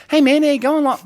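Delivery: high, loud mock-friendly greeting; imitating a friend. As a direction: greet a mate in a high, put-on voice. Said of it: "talking to me, like".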